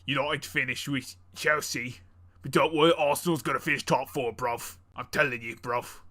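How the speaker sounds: light mock British football fan; a touch of British accent, reassuring a mate about Arsenal. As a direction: keep up the hammed-up London bloke. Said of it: "the U K is [name]".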